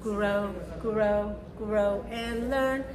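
ordinary talk, nothing marked

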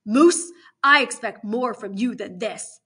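loud, slow reproach; emphatic warden's rebuke; stern disappointment. Acted. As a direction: weigh each word as you scold. Warden singles out Moose.

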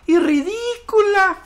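very high, stretched-out jeer; mocking ridicule. A narrator's reading. Rectangle piling on the insults, mocking. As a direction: drag it out high, full of scorn. Shapes mock the newcomer.

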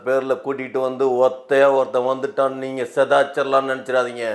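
low, level warning; serious. Acted. Guest warns the interviewer not to push him around, serious. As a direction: keep it grave, pitched well down. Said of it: "சீரியஸா சொல்றேன்".

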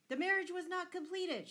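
high, loud angry objection; shouted. A narrator's reading. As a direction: shout it down as an outraged lord. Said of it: "shouted Lord Fitzwalter".

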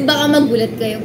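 normal talking voice

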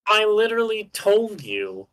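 high, exasperated insistence; angry yelling. Real, not acted. Player insisting teammate was told, angry yelling. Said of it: "Stop yelling".

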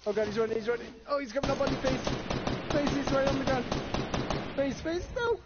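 high urgent warning shouts; alarm shout. Real, not acted. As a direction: yell warnings to a teammate. Teammate in danger, shouting warnings.

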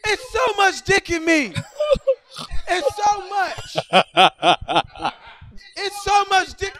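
high-pitched mock cry of a woman overwhelmed; high mock moan. Mimicking the woman in bed, high strained cry. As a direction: squeal it out high like she can't take it.

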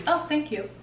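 ordinary talk, nothing marked

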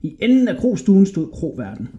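plain reading voice

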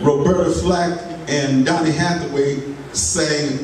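normal talking voice

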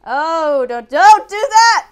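high, loud panicked shout; panicked yelling. Real, not acted. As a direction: yell in real panic. Gamer panicking at enemy.

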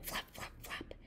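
very soft voiceless "flap, flap, flap"; wing flaps. Acted. Eagle wings right overhead, soft flaps. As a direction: whisper the wingbeats. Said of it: "He heard it again".

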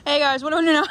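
laughing. Real, not acted. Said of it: "repeated line".

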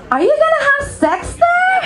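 very high, squealed question; hammed-up incredulous squeal; blunt blurting. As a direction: blurt it out in a mock high-pitched friend voice. What the friend actually blurted out.

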